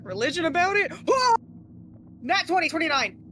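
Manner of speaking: loud, high excited cry; yelling in triumph. Real, not acted. Rolls a natural twenty.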